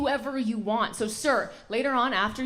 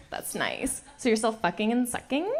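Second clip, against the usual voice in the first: ordinary talk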